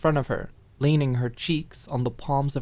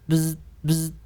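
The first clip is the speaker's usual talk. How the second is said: phone buzz; 3 semitones higher than usual. Acted.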